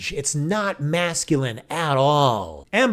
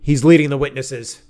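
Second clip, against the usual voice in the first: loud, hammed-up courtroom objection; mock lawyer; 8 dB louder than usual. Mock lawyer objecting to the judge about leading questions, loud objection. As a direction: object loudly like a trial lawyer.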